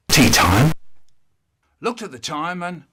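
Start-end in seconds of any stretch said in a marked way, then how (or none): 0.1-0.7 loud put-on voice. Answer in put-on voice.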